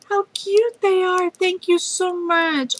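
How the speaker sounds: high, slow gushing thanks; gushing over a cute present; delighted gushing. Real, not acted. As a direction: coo over the gift. Delighted by cute stamps in mail.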